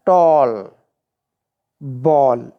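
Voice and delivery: टॉल and बॉल each drawn out and falling; matching low, lengthened टॉल, बॉल; rhyme demonstration. Shown as a demonstration. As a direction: set टॉल and बॉल apart, low, stretching the shared ending. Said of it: "ये similar sound हो गया".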